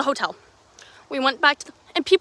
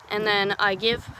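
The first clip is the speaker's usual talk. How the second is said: ordinary delivery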